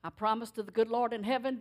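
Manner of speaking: ordinary talk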